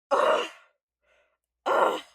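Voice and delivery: two loud, forceful groans of frustration; exasperated ugh. Pretend. Fan reliving an upsetting ending, exasperated groans. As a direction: groan hard, twice, exasperated.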